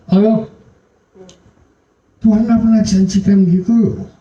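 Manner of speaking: high, loud, pressing sermon voice; full-force pulpit preaching; fervent preaching. Acted. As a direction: preach it up to the congregation with fire.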